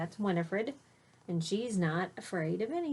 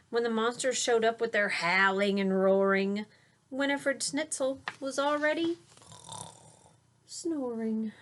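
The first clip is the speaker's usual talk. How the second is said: put-on lilting rhyme read to a child; read-aloud storytelling; 6 semitones higher than usual. A narrator's reading. Parent reading a rhyming bedtime book aloud to a child. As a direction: read the verse with a gentle bounce.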